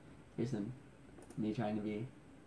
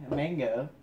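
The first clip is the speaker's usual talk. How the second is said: ordinary talk, nothing marked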